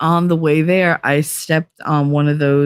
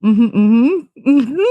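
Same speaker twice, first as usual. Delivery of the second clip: rising, higher, louder mm-hmms; a boyfriend humoring his giggling girlfriend, amused; 6 semitones higher, 4 dB louder, much slower than usual. Retold or imitated.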